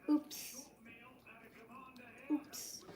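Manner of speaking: whispered guilty oops; a soft whispered oops; sheepish whisper. Real, not acted. Sheepish confession of drinking.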